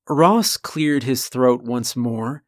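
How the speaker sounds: usual speaking voice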